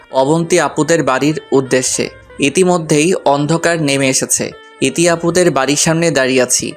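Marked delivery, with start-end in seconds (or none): none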